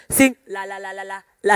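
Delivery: ordinary talk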